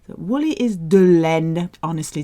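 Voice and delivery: ordinary delivery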